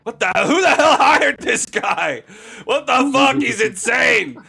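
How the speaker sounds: high, loud frantic shout; panicked yelling. Acted. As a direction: yell at him in frightened disbelief. NPC yells in terror.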